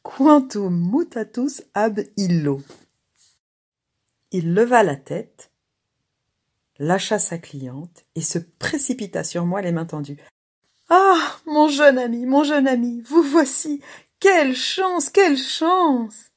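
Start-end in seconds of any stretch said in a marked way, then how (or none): 0.0-2.6 slow, loud Latin declamation; loud theatrical exclamation; exclaimed. A narrator's reading. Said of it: "Je m'écriai". 10.9-16.3 very high, loud cries of joy; ecstatic, voice pitched right up; overjoyed greeting. A narrator's reading. Joyful reunion with old pupil.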